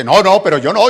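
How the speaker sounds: loud, acted refusal; mock protest. Prophet refusing the king's money, loud mock indignation. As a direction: protest loudly as the offended prophet.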